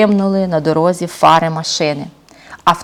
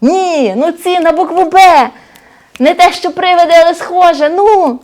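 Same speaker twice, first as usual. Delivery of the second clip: розгублений бандит; 7 semitones higher than usual. Acted. Злодюга пригадує слово.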